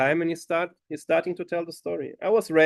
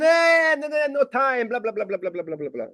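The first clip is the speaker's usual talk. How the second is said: dismissive brush-off; 9 semitones higher than usual. Retold or imitated. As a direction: wave it off like a man in a hurry.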